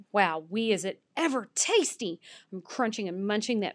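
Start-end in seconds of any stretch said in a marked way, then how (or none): none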